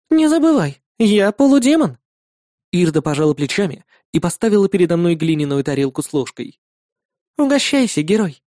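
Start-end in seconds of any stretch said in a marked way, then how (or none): 0.1-2.0 put-on high bright girlish voice; young woman voice. A narrator's reading. Narrator voices Irda, female. 7.4-8.4 put-on higher, modest girlish voice; modest young woman. A narrator's reading. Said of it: "скромно произнесла она".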